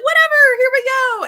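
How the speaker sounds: carefree shout. Retold or imitated. Guest brushing off time limits, loud carefree whoop. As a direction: toss off the schedule with a big yell. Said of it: "he's just like".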